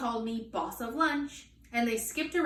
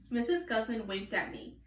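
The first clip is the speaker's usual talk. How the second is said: ordinary delivery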